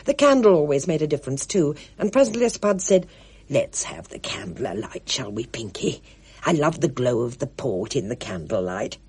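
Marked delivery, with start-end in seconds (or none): 3.5-9.0 put-on low, hushed elderly woman; Spud's hushed voice. A narrator's reading. Spud asks Pinky to light the candle, hushed. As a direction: hush it, cosy by the candle.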